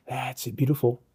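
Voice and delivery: quiet, approving murmur; soft encouragement. Real, not acted. Photographer encouraging the model as she poses, quietly approving. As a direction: gently praise under the breath.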